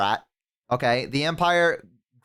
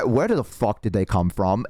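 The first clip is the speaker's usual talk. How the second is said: ordinary talk, nothing marked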